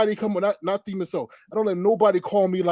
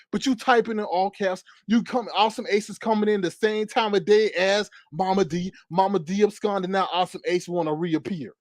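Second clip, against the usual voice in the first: usual speaking voice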